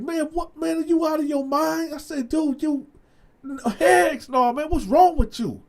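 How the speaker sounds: high-pitched disbelief throughout; incredulous denial. Retold or imitated. Dante denying the affair to his friend, high incredulous protest. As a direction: protest like the idea is insane. Dante denies sleeping with wife.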